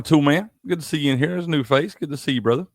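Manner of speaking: ordinary talk, nothing marked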